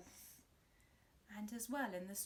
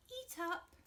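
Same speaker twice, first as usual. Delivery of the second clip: mum; 9 semitones higher than usual. Acted. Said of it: "Mum said".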